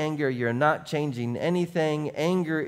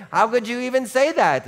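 mock yell; 8 semitones higher, 4 dB louder than usual. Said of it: "they'll scream even louder".

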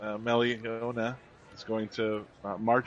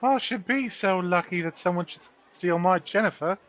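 lightly higher-pitched character mother; a hint of fretful mother; put-on mother voice; 7 semitones higher than usual.

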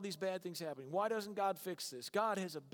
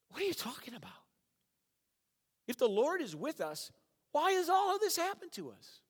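much higher, louder disbelieving protest; incredulous Gideon; 11 semitones higher, 4 dB louder than usual. Retold or imitated. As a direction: Gideon can't believe what he hears. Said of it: "And then he's like".

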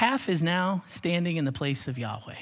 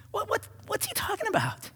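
high, soft stammering disbelief; mock bafflement; 6 dB quieter than usual. Preacher reacting to Aaron's absurd excuse, baffled. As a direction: squeak out the confusion, pitched way up.

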